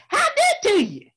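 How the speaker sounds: high, loud, cheerful call; Brer Rabbit greeting. Acted. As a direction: sing out the greeting to the crowd.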